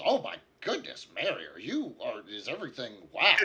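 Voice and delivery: put-on fussy startled old wizard; sleepy old wizard.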